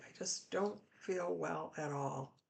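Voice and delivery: normal talking voice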